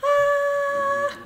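long, high held "ahh"; high open "ah". Shown as a demonstration. Leader showing children an open little mouth, high ah.

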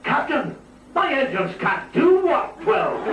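high, slow, hammed-up Scotty brogue; mock Scottish engineer protesting to the captain. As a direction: belt it out like Scotty, Scottish burr. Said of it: "the familiar".

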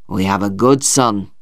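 low, heartfelt voice; proud father. A narrator's reading. Father praises his son to his wife, proud.

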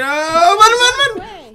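high, loud panicked cry; panicked yelp. Pretend. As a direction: yelp it out as you flee. Fleeing in the game.

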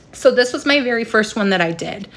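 ordinary talk

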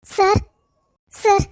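calling out. Acted. Wife calling out to officers.

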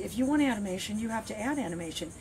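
normal talking voice

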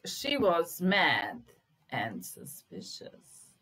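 very slow, loud on "she was mad"; teacher reading a test sentence aloud; slow dictation. Shown as a demonstration. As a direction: dictate it slowly to the class. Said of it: "slow dictation of test sentence".

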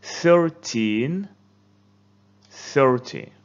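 thirTEEN high and rising, THIRty falling; stress contrast. Shown as a demonstration. Teacher leads class repeating the pair. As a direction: model it slowly for repeating. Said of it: "Listen and say it together with me".

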